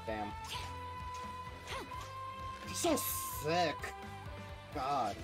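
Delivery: ordinary talk, nothing marked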